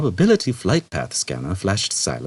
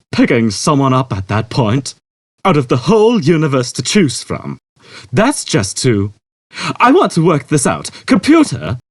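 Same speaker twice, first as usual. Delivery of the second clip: higher and louder, building to a call; Zaphod, eager; 8 semitones higher, 6 dB louder than usual. A narrator's reading. Zaphod calling the ship's computer, loud and eager. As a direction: work it out then summon the computer.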